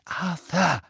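warning. A narrator's reading. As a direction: cut in to caution your husband. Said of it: "warnte Misses Weasley ihn". (warnend, mahnend)

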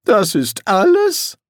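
high, slow disbelieving question; can't believe the request; wizard voice. Acted. Wizard doubts the summoner, high and slow.

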